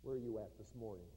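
preacher inviting listeners to respond, gently; gentle altar call. Acted. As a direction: ask them softly and personally. Gentle appeal to the congregation.

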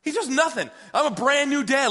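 usual speaking voice

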